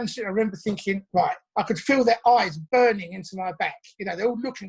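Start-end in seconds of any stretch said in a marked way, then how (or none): none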